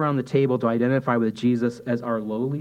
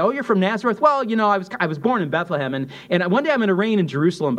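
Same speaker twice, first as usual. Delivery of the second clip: imagined boastful reply; 8 semitones higher, 5 dB louder, faster than usual. Retold or imitated. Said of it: "If they say".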